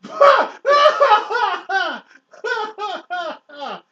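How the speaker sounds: loud, high cackling laugh; real burst of laughter. Real, not acted. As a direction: crack up helplessly, long and loud. Listener cracks up at retold line.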